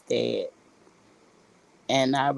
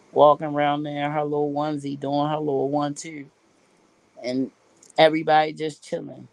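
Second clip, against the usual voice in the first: ordinary talk, nothing marked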